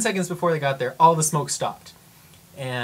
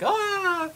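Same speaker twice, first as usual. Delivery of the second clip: much higher, short yelp as if falling; falling cry. Retold or imitated. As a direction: yelp like someone tumbling down. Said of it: "not fall, like".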